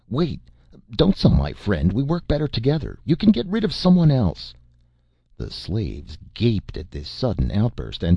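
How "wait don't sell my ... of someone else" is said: rising desperate shout, pitch climbing high. Acted. Jason shouts after Ch'aka not to sell his friend, rising desperate yell. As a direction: call out after him, pleading and getting higher. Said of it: "Jason shouted after Ch'aka".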